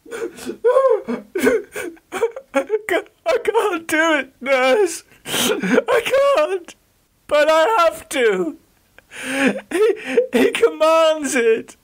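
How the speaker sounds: high, broken crying words; Geoffrey sobbing to the nurse. Acted. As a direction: weep through every word. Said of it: "Geoffrey fell to crying, his sobs heaving his chest".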